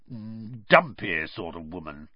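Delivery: low, grudging growl. Acted. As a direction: growl it out like a sulky bear. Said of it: "he growled finally".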